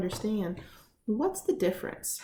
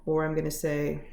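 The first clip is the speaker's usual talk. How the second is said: ordinary delivery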